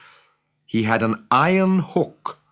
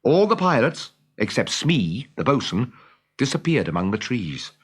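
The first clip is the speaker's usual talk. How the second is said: usual speaking voice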